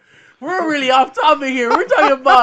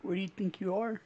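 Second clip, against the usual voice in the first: low, very soft voice; hushed, lowered mock-solemn line; hushed movie quote; 6 semitones lower, 18 dB quieter than usual. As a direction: say it gently and low, teasing him toward tears. Quoting the sad episode to friend.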